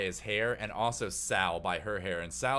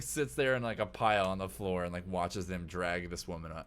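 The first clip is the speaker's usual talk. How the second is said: normal talking voice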